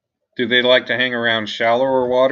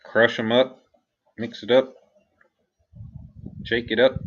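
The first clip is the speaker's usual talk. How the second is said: ordinary delivery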